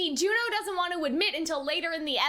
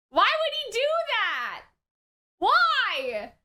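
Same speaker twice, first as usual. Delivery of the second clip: exasperated; 6 semitones higher, 5 dB louder, slower than usual. Real, not acted. Upset reaction to scene.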